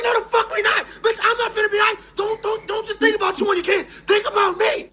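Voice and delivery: high, loud indignant shouting; mock outraged yell. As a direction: yell back at the screen in protest. Yelling back at Pepper in retold scene.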